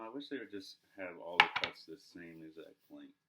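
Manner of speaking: ordinary talk, nothing marked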